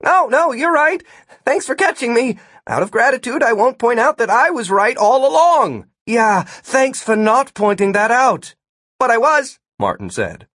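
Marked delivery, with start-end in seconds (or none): 0.0-5.9 high-pitched, heated backing down; raised-voice mock gratitude; grudging concession. A narrator's reading. Martin backs down, still arguing. 6.1-8.5 slow, high-pitched sarcasm; exasperated raised voice; sarcastic thanks. A narrator's reading. Gwen's sarcastic reply in quarrel. 9.0-9.6 high, stubborn interjection; raised-pitch quarrelling; insistent protest. A narrator's reading.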